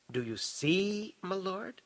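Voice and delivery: slow, hushed wonder. A narrator's reading. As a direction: ask in awed disbelief, pitch lifting. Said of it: "wonder in his tone".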